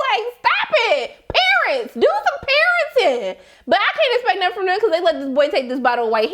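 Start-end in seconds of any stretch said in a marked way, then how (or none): 0.1-3.4 laughing scold. Real, not acted.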